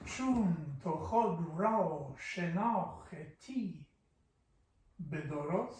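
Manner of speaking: slow, measured recital of Persian verse; slow poem recital. Shown as a demonstration. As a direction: lay out each line slowly, like a poet.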